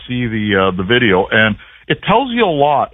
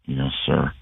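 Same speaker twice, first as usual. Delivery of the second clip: subdued, much softer mumbled yes sir; half-hearted answer from a tired team; very low reply; 9 semitones lower, 9 dB quieter than usual. Retold or imitated. Players answering their angry coach, low and subdued. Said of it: "Like, very low".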